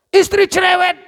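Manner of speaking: loud, high-pitched preaching cry; preaching at full force. Acted. Preacher crying out to the congregation, full force. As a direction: hammer the point home to the congregation.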